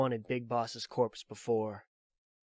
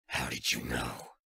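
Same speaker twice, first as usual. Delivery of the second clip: put-on much lower, a weary old soldier; Snake's voice; 12 semitones lower than usual. Old soldier explains his pursuit to Drebin, low and weary. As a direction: drop into the tired veteran's low voice.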